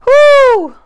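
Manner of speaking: loud, high "whoo!"; playful high whoo; high whoop. Real, not acted. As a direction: let out a big falling whoop. Wiggle warm-up, whooping along.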